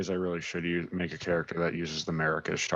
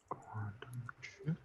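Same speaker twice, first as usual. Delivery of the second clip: whispered note to self; whispering; 4 semitones higher, 15 dB quieter, much slower than usual. Real, not acted. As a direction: murmur it under breath while writing. Repeating notes under breath.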